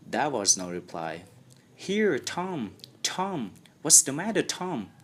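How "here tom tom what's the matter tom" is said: put-on higher, worried boy's voice; anxious. A narrator's reading. Sid anxiously asks his groaning brother what's wrong. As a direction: anxiously shake him awake. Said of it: "looked in his face anxiously".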